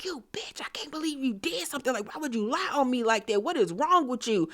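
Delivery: fast, hushed, unvoiced rant; whispered fury. Uba seething at Brienne, a fast furious whisper. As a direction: hiss it fast under your breath. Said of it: "because Uba is like".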